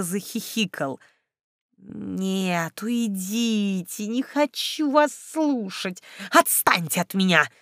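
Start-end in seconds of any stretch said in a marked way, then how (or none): none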